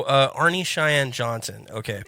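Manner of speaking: ordinary delivery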